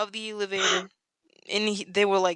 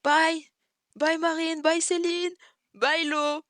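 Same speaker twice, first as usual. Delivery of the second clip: cheerful call to friends from the car; calling goodbye; 9 semitones higher than usual. Retold or imitated. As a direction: wave them off by calling.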